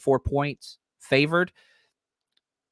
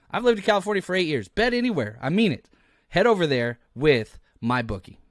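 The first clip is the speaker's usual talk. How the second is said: higher-pitched, punchy promo read; sponsor ad read; 3 semitones higher, 3 dB quieter than usual. Real, not acted. Host pitching a betting sponsor to listeners, ad read. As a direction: sell it with lifted key phrases.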